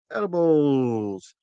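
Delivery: long, sliding, savoured word; slow showy announcement; drawn-out reveal. Real, not acted. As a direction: stretch the word out very slowly.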